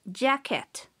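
ordinary talk, nothing marked

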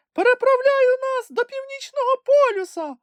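very high, squeaky little creature voice; a tiny animal piping up in excitement; Kulka exclaiming. A narrator's reading. Kulka tells Grampus they are almost there, squeaky excited cry.

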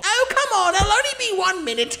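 put-on very high, fast cracked old-lady voice; shrill laughing grandma; old granny complaining. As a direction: whine like an impatient granny. Voicing Granny pleading.

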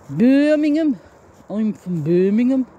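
broad Brummie, high and loud, stretching Birmingham; Brummie accent. Shown as a demonstration. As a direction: show off a Brummie accent with pride. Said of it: "I can still do it".

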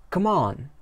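lightly acted summons from the guard; calling over.

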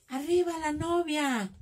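mock wedding cheer. Crafter imitates guests cheering the bride at a wedding, mock shout. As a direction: call it out slowly like a guest cheering. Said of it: "Cuando gritan".

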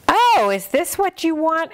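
high bright offering voice; kind librarian. Acted. The librarian hands the hen books, high and kind. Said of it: "asked the librarian".

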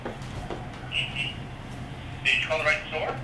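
ordinary talk, nothing marked

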